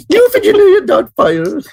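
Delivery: put-on very high, laughing old-lady voice; hammed-up falsetto through a giggle; falsetto matron. Host teasing back after being compared to Doubtfire, silly falsetto matron.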